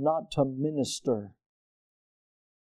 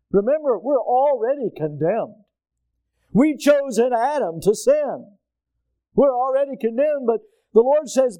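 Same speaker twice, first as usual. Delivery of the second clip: higher, louder, driving the point home; raised pulpit voice building to a cry; full-force preaching; 8 semitones higher, 4 dB louder than usual. Acted. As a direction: preach it out over the congregation.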